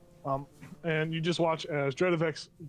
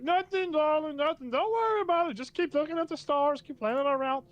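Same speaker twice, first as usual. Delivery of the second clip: sweet-talking alien; 13 semitones higher, 4 dB louder than usual. Acted. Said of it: "And Dredovek's like".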